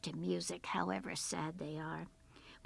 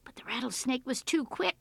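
ordinary delivery

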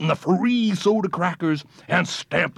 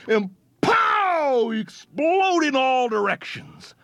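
excited; 8 semitones higher than usual. A narrator's reading. Explosion climax.

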